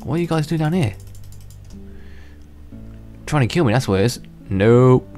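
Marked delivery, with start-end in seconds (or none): none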